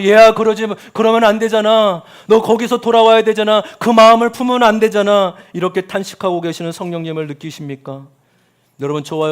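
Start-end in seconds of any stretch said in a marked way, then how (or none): 0.0-5.3 put-on loud, high pleading call to a child; sighing plea. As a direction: beg the child to come back. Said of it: "끊임없이 탄식하고".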